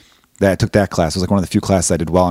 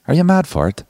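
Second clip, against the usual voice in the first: higher-pitched mock British accent; English accent; 7 semitones higher than usual. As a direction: put on a nineties English lad's accent. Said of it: "England in the nineties".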